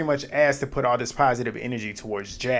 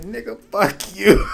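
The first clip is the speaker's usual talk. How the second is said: defiant retort; 7 semitones higher, 4 dB louder, slower than usual. Retold or imitated. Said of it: "I'd have said".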